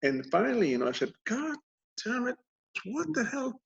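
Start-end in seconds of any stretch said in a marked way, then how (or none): 1.3-3.6 high, softened curse in broken pieces; mock exasperation, squeaking up quietly; retold exasperated bafflement. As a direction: replay puzzled annoyance in a high, small voice. Retells baffled frustration while scanning.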